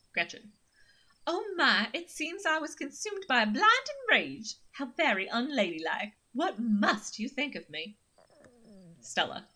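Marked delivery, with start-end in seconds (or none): 1.3-8.0 lightly held Southern Belle, higher at the start, then a little coy; Southern Belle accent. Acted. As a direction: Gretchen embarrassed, a prim Southern Belle apology. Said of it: "my Southern Belle accent for Gretchen".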